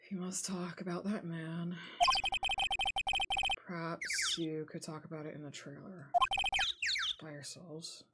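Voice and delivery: low, soft, half-whispered; quiet threat, drawing them aside; hushed cold witness. Acted. Witness telling the lawyer to talk privately, hushed.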